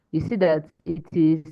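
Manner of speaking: ordinary talk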